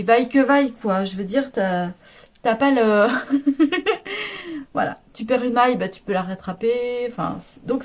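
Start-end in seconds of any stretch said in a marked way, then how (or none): 3.1-4.7 short laugh. Real, not acted. Laughing at her own phrase.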